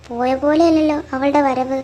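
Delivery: loud, forceful complaint; upset questioning. Acted. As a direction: demand loudly to know what is wrong with her. Upset grandmother questions son. (ഉറക്കെ പരാതിപ്പെട്ട്)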